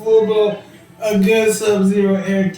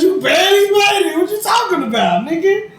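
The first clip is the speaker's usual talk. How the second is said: mock God retort; 11 semitones higher than usual. Retelling God's retort.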